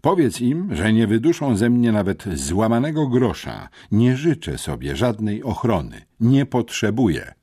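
loud, high, emphatic bravado; heroic defiance. A narrator's reading. Boy sends defiant word back to the gang, heroic. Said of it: "powiedziałem bohatersko".

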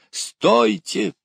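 high, sharp shouted halt; shouting. A narrator's reading. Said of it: "закричал Кюхельбекер". (высокий крик-приказ)

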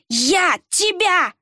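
high, drawn-out furious threat; enraged growl. A narrator's reading. Villager lunging at the pastor, growling in rage. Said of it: "прорычал он".

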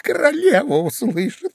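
high, urgent hushed warning; pleading whisper. A narrator's reading. Rabbit warns Alice of the queen, pleading. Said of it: "умоляюще зашептал кролик".

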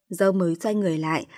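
ordinary delivery, nothing marked

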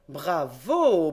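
mock-applaud the reasoning; Fred cheers the young reporter; loud bravo. A narrator's reading. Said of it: "fit encore le grand Fred".

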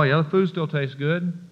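normal talking voice

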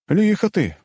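high, teasing exclamation; amused smirk. A narrator's reading. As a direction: tease him with amused admiration. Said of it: "усмехнулся я". (с усмешкой, высоким голосом)

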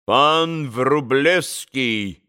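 put-on very slow Polish-accented prompt; lightly voiced Polish gentleman. Pan on the sofa prompting the name, Polish accent.

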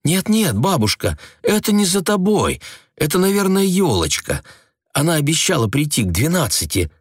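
put-on girl hurriedly reassuring her grandmother; hasty reassurance. A narrator's reading. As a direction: rush to calm grandmother. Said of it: "поспешно сказала Ася".

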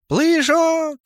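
very high, sustained kiddie squeak; childish voice. A narrator's reading. Gnome proposes jumping down, in a squeaky child's voice. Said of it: "детским голосом промямлил гном".

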